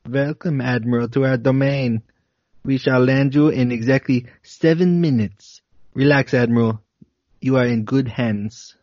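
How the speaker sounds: put-on light Nordic-Germanic accent, calm and welcoming; a hint of a Germanic accent greeting the admiral; slight Germanic accent. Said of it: "slight Nordic or Germanic accent".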